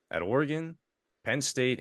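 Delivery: usual speaking voice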